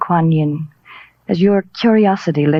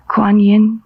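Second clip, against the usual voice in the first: crave it, breathless with desire; rapt longing; 3 semitones higher, slower than usual. Acted. Kate begging Danny for the statue, obsessed.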